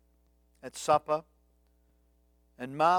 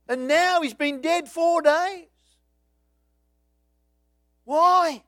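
grieving reproach; 8 semitones higher, 8 dB louder than usual. Retold or imitated. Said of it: "Does it reek of anger? Is it a grieving soul?".

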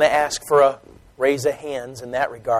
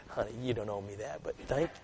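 soft gentle reply; 5 semitones lower, 21 dB quieter than usual. Acted. Wife answers her husband.

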